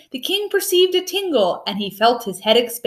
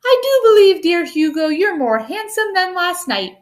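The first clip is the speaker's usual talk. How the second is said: higher, self-admiring king's voice; vain admiring; 6 semitones higher than usual. A narrator's reading. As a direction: coo at your own reflection. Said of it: "admiringly and said".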